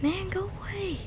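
scared whisper. Real, not acted. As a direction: hush it so the monster won't hear. Whispering at the monster.

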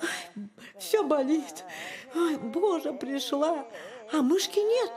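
high, whining moan of pain; beaten, cross fox. A narrator's reading. Battered fox comes home and finds no mouse, whiny moaning. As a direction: whine and complain in a high, aching voice. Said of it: "вся побитая, вся злая".

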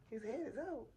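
high, incredulous echo; retold astonishment. Mother learning baby's head is out, astonished. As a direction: act out disbelief, voice swooping up. Retelling her astonished reply.

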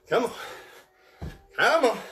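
ordinary talk, nothing marked

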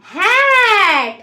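"হ্যাট" stretched out very slowly on one long vowel; pronunciation demo. Shown as a demonstration. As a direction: model "হ্যাট" for pupils, drawing it right out. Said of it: "এবারে উচ্চারণ করো তাহলে". ("হ্যাট" টেনে লম্বা করে উচ্চারণ)

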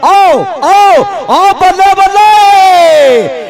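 long, high, loud calls of bravo; rally the crowd with stretched cries; drawn-out cheering shout. Acted. Cheering on the raid.

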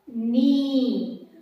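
knee said as "nee", drawn out; silent K. Shown as a demonstration. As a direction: say knee with no K sound at all. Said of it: "but we pronounce". (knee ಅನ್ನು "ನೀ" ಎಂದು ಉಚ್ಚರಿಸಿ)

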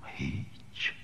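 breathy hush of a single word, voice dropped away; whispered answer. Acted. Poet answering his own question in a resigned whisper. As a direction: let the word fall to a bare whisper.

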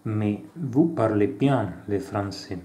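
reading out a café dialogue with light character voices; acted dialogue reading. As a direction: play both sides of the café scene, lightly. Reads French café dialogue aloud. (lettura animata di un dialogo in francese)

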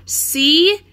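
high, loud taunt; defiant shout. A narrator's reading. Annie taunts the vulture overhead, high and loud. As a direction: throw it up at the bird.

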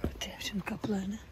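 soft whisper while closing the doors. Real, not acted. Closing the animals' doors for the night, whispered. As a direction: keep it hushed.

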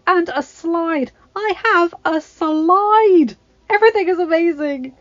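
put-on high, loud, breathless girl's voice; excited young Mirabelle. A narrator's reading. Mirabelle showing off her magical room, thrilled and high. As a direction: squeal over every new thing.

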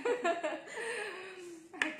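bursting out laughing; big hearty laugh; loud laugh. Real, not acted. Laughing at spicy dipping sauce.

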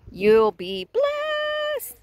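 high, slow, stretched out; high drawn-out preaching; drawn-out promise. Acted. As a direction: sing it out as a blessing. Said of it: "preaching promise".

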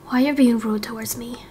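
fast, breathy whispered plea; hurt whisper. Acted. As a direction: whisper it, wounded. Hurt character whispering a complaint.

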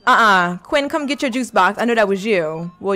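ordinary talk

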